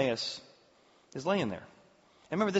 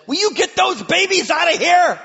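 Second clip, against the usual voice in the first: high, loud shout to clear the babies; angry shout; 11 dB louder than usual. Acted. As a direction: bark at them to get out. Disciples' rebuke acted.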